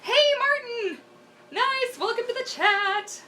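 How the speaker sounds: high, bright welcome to a viewer; excited greeting. Real, not acted. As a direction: call out a cheery hello, pitched high.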